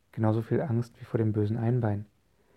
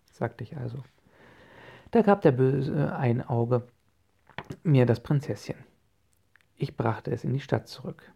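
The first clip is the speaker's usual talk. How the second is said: ordinary delivery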